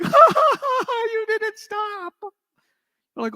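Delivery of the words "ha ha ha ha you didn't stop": much higher, louder giggling; mock laughter. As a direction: laugh like a tickled kid. Kid laughing, tickled.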